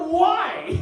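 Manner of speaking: high, loud disbelieving why; shocked why. Retold or imitated. Son learning mom lied about soda, high shocked outburst. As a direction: yelp it in outrage. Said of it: "I was like".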